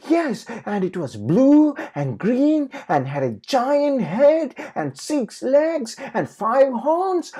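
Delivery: put-on high, loud, slow child's outburst; piling on details at full voice; excited cry. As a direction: piglet crying out. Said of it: "Polly cried".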